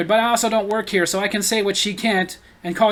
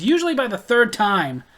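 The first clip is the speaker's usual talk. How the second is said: ordinary talk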